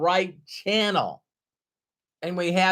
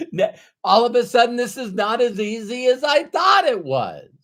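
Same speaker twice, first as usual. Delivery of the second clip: laughing exclamation; 6 semitones higher, 3 dB louder than usual. Real, not acted. Laughing at informed pessimism.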